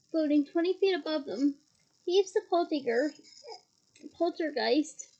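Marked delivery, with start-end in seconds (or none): none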